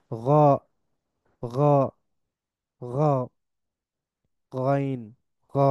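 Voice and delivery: গ gargled in the throat like Arabic ghain; ghain demo. Shown as a demonstration. Teacher drilling students on ghain. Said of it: "এটার উচ্চারণ হইতেছে".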